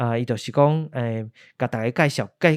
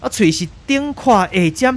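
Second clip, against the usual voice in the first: shrill alarm, much higher and louder throughout; a newsman losing his nerve on air; terrified reporter; 9 semitones higher, 5 dB louder than usual. Acted. Reporter Carlo seeing tentacles emerge live, terrified and loud. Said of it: "咱继续转来甲卡洛这边".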